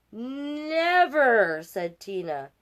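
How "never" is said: high, loud, drawn-out refusal; defiant never. Acted. Tina flatly refuses her mom, loud and defiant. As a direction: plant your feet and refuse.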